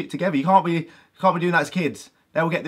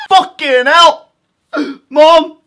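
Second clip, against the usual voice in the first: loud, high scream of pain; 9 dB louder, much slower than usual. Retold or imitated. Kid with shampoo in his eye screaming for Mom.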